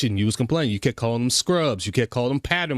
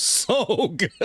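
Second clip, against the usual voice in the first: slow words broken by a real laugh; 5 semitones higher than usual. Real, not acted. Telling Mars about Evil's rant, laughing. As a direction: laugh your way through it, delighted.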